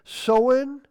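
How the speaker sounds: "Sowin" said SO-in, O as in glow; dialect pronunciation. Shown as a demonstration. Preacher listing Gaelic dialect variants, "Sowin" said SO-in. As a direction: say "Sowin" with a long O. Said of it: "with O like in glow".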